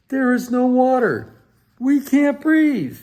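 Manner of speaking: high, loud gasping alarm; panicked guests. Acted. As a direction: cry out that there's no air. Guests suffocating at party.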